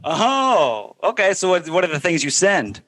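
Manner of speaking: ordinary delivery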